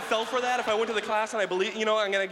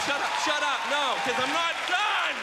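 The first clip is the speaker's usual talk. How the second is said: excited shout; 7 semitones higher than usual. Real, not acted. Heckling audience.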